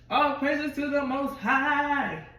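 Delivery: slow, loud, high proclamation of thanks; a slow, loud and high cry of gratitude; joyful praise. Retold or imitated. Joyful praise on getting room.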